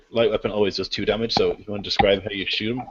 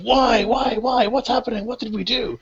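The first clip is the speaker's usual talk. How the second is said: high, frantic bleating panic; panicked goat men; 14 semitones higher than usual. Acted. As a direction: shriek it like terrified goat creatures. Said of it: "you hear shouts of".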